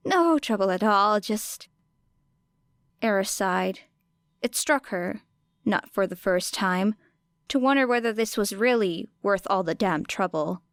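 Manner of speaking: plain reading voice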